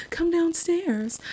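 high, very slow ghost call. Retold or imitated. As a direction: draw it out like a ghost. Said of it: "The Bound Woman is saying".

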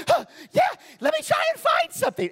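high, loud imitation of an eager woman; mock high-pitched excited contestant; lit up, excited. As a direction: gasp and squeal, frantic to win. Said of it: "these ladies lit up".

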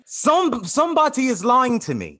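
high-pitched, affronted protest; aggrieved, accusing outburst; indignant accusation. Acted. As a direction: pitch it up like someone wronged. Guest accuses host of lying.

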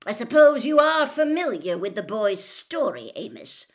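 loud, high, angry challenge; barked. A narrator's reading. Mister Crouch challenging Amos about the boy, barking. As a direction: bark a pointed question. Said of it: "barked Mister Crouch".

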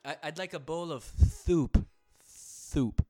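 thick lisping peanut-butter mouth; mouth full of peanut butter. Pretend. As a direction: speak with a sticky mouth. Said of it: "peanut butter stuck to the roof of your mouth".

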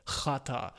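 Chata with an exaggerated kh start; mocking throat-scraped ch opening Chata; throaty Ch. Shown as a demonstration. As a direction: rasp the first sound of Chata. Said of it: "X wird nicht wie C H ausgesprochen".

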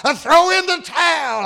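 retold ringside shout, pitched high; crowd hollering. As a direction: holler it like the fans at the ring. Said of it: "started hollering at him".

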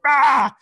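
sudden loud mock yell of a temper snapping; short loud yell; mock angry shout. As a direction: bark it out like a dad losing it. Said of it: "I didn't like him shouting".

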